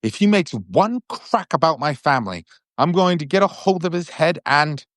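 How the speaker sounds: furious Ron. A narrator's reading. Ron threatening Malfoy.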